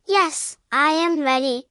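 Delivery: usual speaking voice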